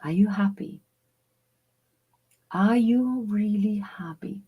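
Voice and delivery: hushed, intimate whisper; a gentle whispered self-inquiry; whispered question. Shown as a demonstration. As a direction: ask it softly to yourself in the mirror. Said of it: "ask yourself".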